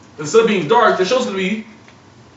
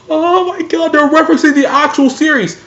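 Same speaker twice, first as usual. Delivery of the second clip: much higher, thrilled fan squeal; excited fan gasp. Retold or imitated. Fan realizing the show references the series, excited high squeal. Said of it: "You're like".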